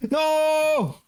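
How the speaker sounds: startled high cry; scared yell. Real, not acted. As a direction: shriek in fright. Horror game jump scare.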